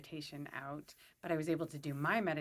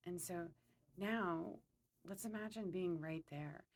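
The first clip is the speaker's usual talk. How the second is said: calm, unhurried meditation guide; guided meditation; 2 semitones lower, 6 dB quieter than usual. Acted. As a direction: lead them gently through a visualization, pausing between phrases.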